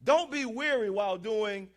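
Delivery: slow, loud scripture proclamation; emphatic preaching. Real, not acted. Preacher quoting Galatians to congregation, emphatic preaching. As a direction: proclaim the verse, weighting each phrase.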